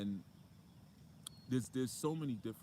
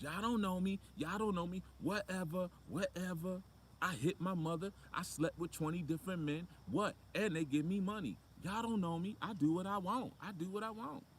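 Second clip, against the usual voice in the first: very high, brash mock-teen bragging; hammed-up defiant kid, pitched way up and pushed; unruly talk-show kid; 10 semitones higher, 3 dB louder than usual. Unruly kid walking onto the Maury stage, high, brash defiance. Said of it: "As soon as they walk on the stage".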